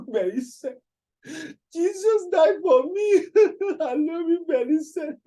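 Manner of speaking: very high, wailing mock sob; mock crying. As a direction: cry it out in a hammed-up squeal. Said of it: "Hey, why you crying".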